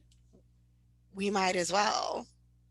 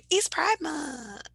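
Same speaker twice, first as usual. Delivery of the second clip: excited; 8 semitones higher, slower than usual. Real, not acted. Cheering Pride Month.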